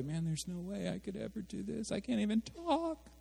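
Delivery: high, soft, whiny despair; hammed-up hopeless moan; self-pitying whine. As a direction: whimper like you're sure you're useless. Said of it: "it goes clear over to the other way".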